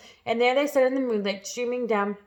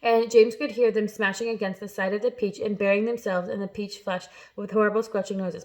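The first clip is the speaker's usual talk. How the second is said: usual speaking voice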